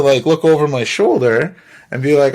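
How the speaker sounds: plain reading voice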